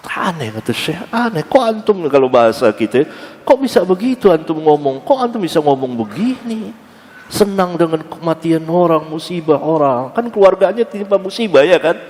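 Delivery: put-on high, reproachful voice of the old teacher; mild scolding teacher. Sheikh reproaching Ibnu Qayyim over rejoicing at a death, mildly voiced. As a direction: lightly voice the sheikh scolding his pupil. Syekh scolding his student.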